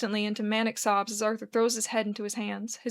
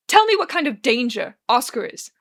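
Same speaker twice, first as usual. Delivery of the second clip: angry demand; 7 dB louder than usual. Acted. Arthur demands an answer, quarrel.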